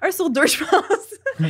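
high, drawn-out words through laughter; laughing. Real, not acted. Podcast guest confirms her estimate to the hosts, laughing. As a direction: laugh while stretching the words out.

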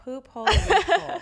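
loud, high, laughing it out; laughing loud repeat. Real, not acted. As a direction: burst out laughing on the phrase. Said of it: "wait, say".

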